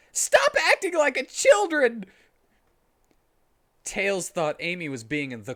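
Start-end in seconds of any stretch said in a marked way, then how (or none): 0.1-2.0 loud, high scolding yell; mad, serious. A narrator's reading. Tails scolding Amy for her drama, loud and high. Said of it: "in a mad, serious voice".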